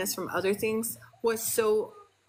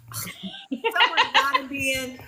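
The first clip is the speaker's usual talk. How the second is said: laughing; 5 semitones higher, 5 dB louder than usual. Real, not acted. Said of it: "laughing at interruption".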